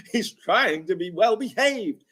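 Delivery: high, slow sneering echo; mocking. Host mocking Craig's excuse, high slow sneer. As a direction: parrot him back with scorn.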